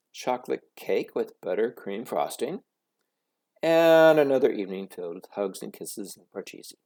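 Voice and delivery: ordinary delivery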